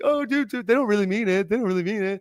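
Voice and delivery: high, dismissive mock dude voice; naive dude. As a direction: brush it off like a clueless bro. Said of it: "we're over here like".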